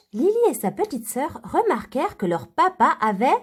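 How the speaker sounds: ordinary delivery, nothing marked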